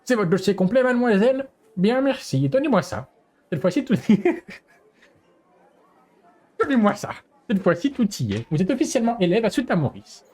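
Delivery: high, formal school director; lightly voiced prim headmistress. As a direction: play the principal accepting the file. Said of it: "je vais pas faire une voix de meuf".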